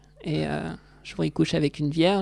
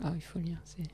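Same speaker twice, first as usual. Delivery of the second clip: whispering; 11 dB quieter than usual. Real, not acted. Aside while recommending a book.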